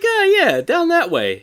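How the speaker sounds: high, loud mock-shifty servant. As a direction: play a liar pointing the wrong way. Mocking shifty servant's directions.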